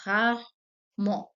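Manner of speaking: rarement said slowly in two syllables; teacher showing the short form. Shown as a demonstration. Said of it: "Or you can use like rarement".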